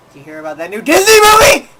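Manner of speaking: loud, sudden mock jump-scare line; spring it on him to make him jump; mock loud pop-up. Imitating Ian popping up.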